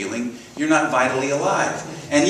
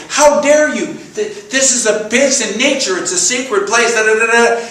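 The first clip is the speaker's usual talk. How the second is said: high, loud indignant outburst; mad, retold; 9 semitones higher, 7 dB louder than usual. Storyteller confronting the golfer in the nature park, acted anger. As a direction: act out outraged scolding, loud and high. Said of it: "and I'm so mad".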